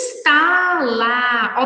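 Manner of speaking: "instalar" very slow, each syllable stretched. Shown as a demonstration. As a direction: sound out "instalar" in long syllables. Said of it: "na separação de sílabas".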